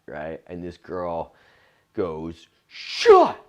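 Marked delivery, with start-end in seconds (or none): 2.7-3.4 shrieked, very high and loud; super loud. Retold or imitated. Drunk girl yells at the comic on stage, high shriek. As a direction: scream it across the room. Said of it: "Like, just super loud".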